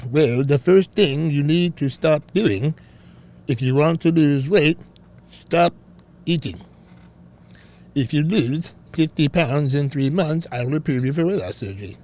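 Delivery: mock foreign doctor giving stern advice; Doctor Now. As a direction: play a foreign-accented surgeon with a Southern touch. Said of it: "mock Doctor Now diagnosis".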